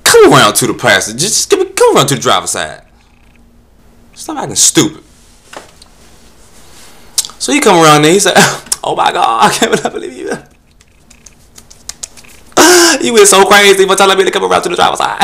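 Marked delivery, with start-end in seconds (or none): 0.0-5.0 loud, exasperated command; loud telling-off. Retold or imitated. Comic telling the solicitor off, loud and exasperated. 8.8-15.2 much higher, loud, fast camp voice; mock campy guy. Solicitor gushing at the driver, high and camp.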